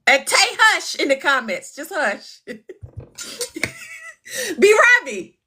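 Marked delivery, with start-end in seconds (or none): 2.6-5.0 shrieking laugh well above her voice; long squealing laugh of relief; high squealing laugh. Real, not acted. After the call ends.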